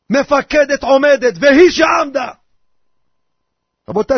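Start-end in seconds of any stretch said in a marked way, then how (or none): 1.4-2.3 loud, raised declamation; commander's declamation. Retold or imitated. Officer explaining the Haggadah to soldiers, loud declamation. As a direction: belt out the line like a commander at attention.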